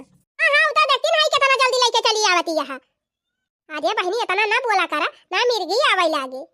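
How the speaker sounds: usual speaking voice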